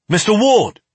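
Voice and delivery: MISTER WARD snapped high and loud; sharp snap. A narrator's reading. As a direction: snap the name to jolt him into action. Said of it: "The sharp snap of my name".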